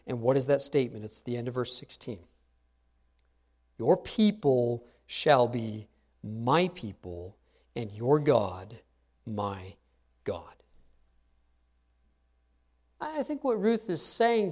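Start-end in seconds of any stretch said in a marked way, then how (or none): 3.8-10.5 hushed solemn climax. Acted. Preacher landing the central line of Ruth's vow, slow and hushed. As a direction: let each word sink, dropping to near silence on my God.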